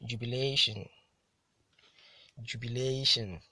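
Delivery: "Jubilation" with the LA hit harder; careful model reading of "Jubilation", stressed on LA; penultimate stress. Shown as a demonstration. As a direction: punch LA in "Jubilation", then fall away. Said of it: "stressed on the penultimate syllable".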